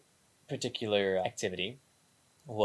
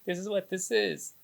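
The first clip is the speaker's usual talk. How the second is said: higher reluctant take; 10 semitones higher than usual. Shown as a demonstration. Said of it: "Instead of like".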